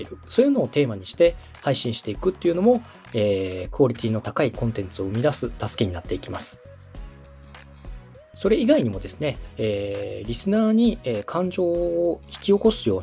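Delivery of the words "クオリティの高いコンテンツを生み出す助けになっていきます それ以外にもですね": normal talking voice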